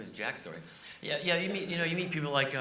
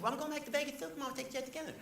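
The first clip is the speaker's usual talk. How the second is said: much higher, light Leno imitation; Jay Leno impression; 13 semitones higher, faster than usual. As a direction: mimic the host casually inviting him. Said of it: "He said".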